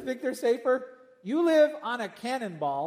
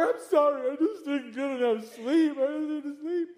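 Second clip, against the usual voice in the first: a hint of a whiny tired grown-up; mock apology, higher and a bit pleading; tired excuse; 5 semitones higher than usual. Tired adult excusing a tantrum, lightly whiny.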